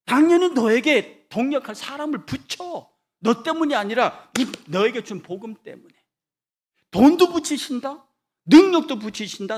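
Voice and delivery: loud, high sermon climax; fervent preacher building to a peak; preaching at full force. Real, not acted. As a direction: drive the message home to the congregation.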